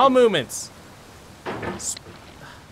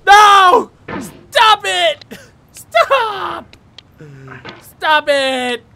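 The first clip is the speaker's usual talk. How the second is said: high, loud, frantic yells; real gamer panic; panicked shouting; 8 semitones higher, 10 dB louder, much slower than usual. Real, not acted. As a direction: shout desperately to halt the fall. Yelling as he slides down.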